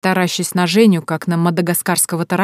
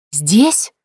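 louder, sharply rising outraged query; outraged question; 5 dB louder than usual. A narrator's reading. Mother and daughter flaring up at once, outraged. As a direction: flare up in disbelief on a rising tune. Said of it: "В один голос взвились мать и дочь".

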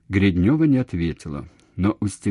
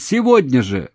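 loud, raised insistence; shout; 5 semitones higher, 7 dB louder than usual. A narrator's reading. Man demanding she come, shouting. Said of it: "закричал он".

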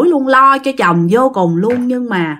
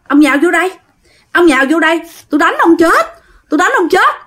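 higher-pitched, mock fighting challenge; acted threat; 7 semitones higher, faster than usual. Possessed father challenging the healer to a fight, threatening. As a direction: dare him to come at you, pitched up.

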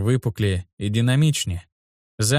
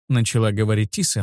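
usual speaking voice

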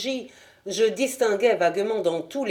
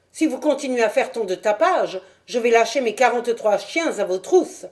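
menace criée; 4 semitones higher, 4 dB louder than usual. Acted. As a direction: menace lancée fort et plus haut. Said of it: "dit une voix". (vieillard qui menace un visiteur nocturne, en criant)

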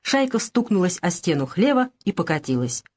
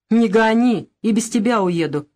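usual speaking voice

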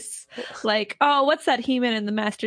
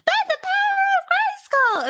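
high-pitched heroic shout of the phrase; He-Man battle cry. Retold or imitated. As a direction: belt it like a cartoon hero. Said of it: "He's like".

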